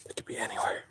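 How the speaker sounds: hushed woodland whisper. Real, not acted. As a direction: whisper it so animals won't hear. Whispering in the woods.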